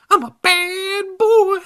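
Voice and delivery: high, slow, loud baby-voiced dummy; shrill baby voice. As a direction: squeal it out like a bratty baby doll. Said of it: "the dummy said".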